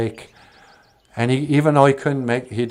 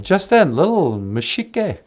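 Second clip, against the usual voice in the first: ordinary delivery, nothing marked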